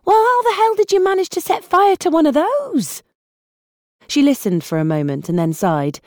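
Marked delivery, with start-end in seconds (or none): 0.1-3.0 very high, disbelieving outburst; high exasperated squeak. Acted. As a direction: squeal it at a hopeless dad. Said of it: "in a voice so high".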